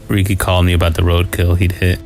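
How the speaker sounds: usual speaking voice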